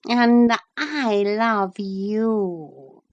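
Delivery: mild purr. Acted. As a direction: purr the words out lazily. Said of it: "purred the cat".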